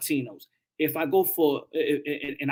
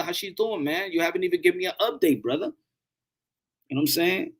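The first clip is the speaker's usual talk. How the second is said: ordinary delivery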